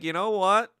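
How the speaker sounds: lightly acted indignant turnaround; imagined partners' reaction. China's partners reconsidering their stock exchange, mock realization. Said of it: "they're probably gonna be like".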